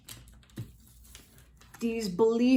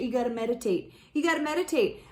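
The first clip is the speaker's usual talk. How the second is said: mocking cliche. Shown as a demonstration. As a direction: roll your eyes at the stock advice. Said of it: "that's, like, so cliche".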